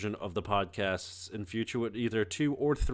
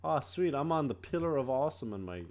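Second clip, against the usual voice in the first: ordinary talk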